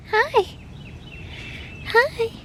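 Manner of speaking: very high squeaky hi; squeaky praising a dog. Real, not acted. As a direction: squeak a greeting at the pet. Greeting the dog.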